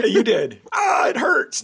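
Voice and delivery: playful yelp, pitch shooting way up; mock pain. Host jokes his co-host pulled a thought from his head, mock yelp of pain. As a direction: pretend the thought was yanked out of your skull, and yelp.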